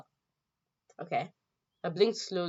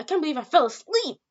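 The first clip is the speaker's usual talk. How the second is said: much higher, louder flustered cry; startled outburst; 10 semitones higher, 9 dB louder than usual. Acted. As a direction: burst out in shock at having dozed.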